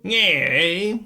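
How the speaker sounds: lightly put-on ballgame announcer; a hint of an old-time baseball announcer; old-timey radio announcer. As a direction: call it like a forties radio broadcast. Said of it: "sound like an old-timey radio".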